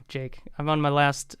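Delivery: usual speaking voice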